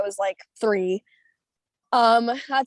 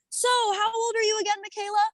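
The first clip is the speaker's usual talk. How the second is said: high, sweet host voice; Tyra Banks asking; 10 semitones higher than usual. Retold or imitated. Host leans in and asks the teen her age, pitched high. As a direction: ask it like a friendly TV host. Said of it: "she comes over to me and she goes".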